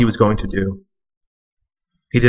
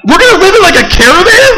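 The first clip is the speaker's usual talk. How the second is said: very high, loud horrified question; mock panic; 7 dB louder than usual. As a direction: squeal it in mock horror. Panics about living in caravan.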